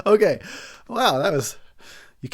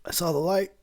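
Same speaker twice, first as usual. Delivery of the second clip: whispering; 4 semitones higher, 5 dB quieter than usual. Pretend. Jokes about nearly dying.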